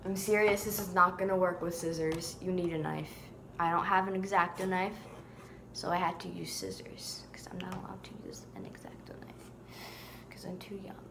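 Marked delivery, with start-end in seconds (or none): 5.7-11.0 whispered confession; confiding whisper. Real, not acted. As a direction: whisper it like a secret. Confiding whisper about knife rule.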